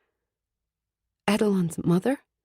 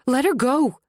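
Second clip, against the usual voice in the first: shout; 6 semitones higher than usual. A narrator's reading. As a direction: yell it lunging forward.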